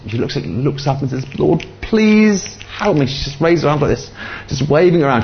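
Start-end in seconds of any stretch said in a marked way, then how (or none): 1.4-3.1 high, slow, drawn-out pleading; imitated high-pitched cry for help; upset plea. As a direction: act out a desperate prayer, stretching please. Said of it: "She's so upset and angry".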